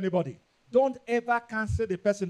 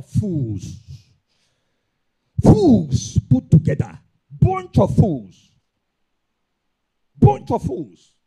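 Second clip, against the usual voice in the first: slow, much louder condemning shout; shouted rebuke; 9 dB louder, much slower than usual. Real, not acted. Preacher condemning erring pastors with a slow furious shout. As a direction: thunder it down at them.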